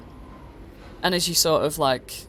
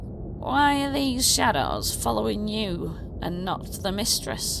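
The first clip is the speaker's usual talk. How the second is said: goblin voice; 5 semitones higher, slower than usual. Acted. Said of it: "he's like".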